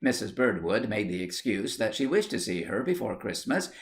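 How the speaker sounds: usual speaking voice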